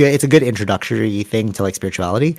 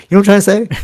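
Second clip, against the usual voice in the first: emphatic high question; 10 semitones higher, 7 dB louder, much faster than usual. Real, not acted. Driving point home to friend.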